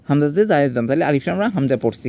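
usual speaking voice

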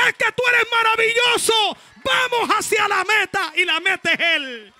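loud high preacher's shout with kisses; preaching at full force. Real, not acted. Preacher leading the church in a loud, high shout. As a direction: shout your love to God.

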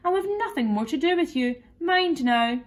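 earnest telling-off, lightly acted; serious warning. A narrator's reading. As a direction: lay down a firm condition, voice lifted. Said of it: "said Alice seriously".

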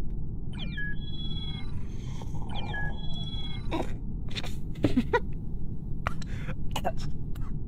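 high-pitched silent wheeze laugh; wheezing laugh. Real, not acted. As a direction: laugh until you can't breathe. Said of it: "Don't make me spit my water".

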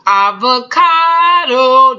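slow, proud announcement; drawn-out reveal. Real, not acted. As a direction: stretch it out like a big reveal. Big reveal of the sour cream.

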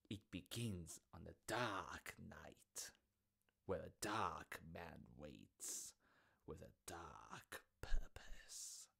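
slow, eerie storyteller whisper. A narrator's reading. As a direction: breathe it like a ghost story. Ominous story opening.

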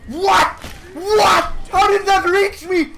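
high, yelped questions; outraged disbelief. Real, not acted. Gamer hit from out of range, high incredulous protest. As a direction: squawk at an impossible hit.

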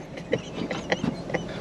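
quick high airy laugh at the crash landing; breathy laugh. Real, not acted. Drone pilot laughing after his rough landing. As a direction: let out a surprised breathy laugh.

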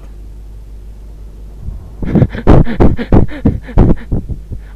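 long, voiceless wheezy laughter; wheezing laugh. Real, not acted. Player cracking up at the game, wheezing laugh. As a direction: laugh on and on, mostly breath.

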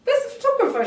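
high, loud disbelieving squeal; shocked at being asked to take photos; incredulous. Retold or imitated. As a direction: react as if the request is absurd. Retelling shocked reply to friend.

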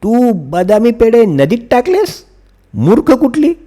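mother pretending to scold daughter; mock scolding. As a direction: scold her in pretend anger. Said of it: "आई नीलावर ओरडली".

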